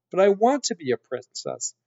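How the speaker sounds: put-on high, pleading girl's protest; protesting serving girl. A narrator's reading. Mig protests to the rat.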